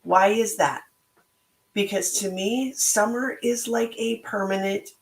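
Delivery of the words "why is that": ordinary delivery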